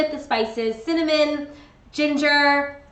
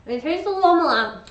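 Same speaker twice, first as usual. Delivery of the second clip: talking with a mouth full of cookie; words muffled by a mouth full; 3 semitones higher, 3 dB louder than usual. Real, not acted. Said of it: "I shouldn't talk with my mouth full".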